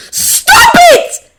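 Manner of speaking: very high, loud desperate scream; full-force youthful shriek. A narrator's reading. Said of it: "screams the familiar voice".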